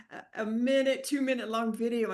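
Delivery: ordinary talk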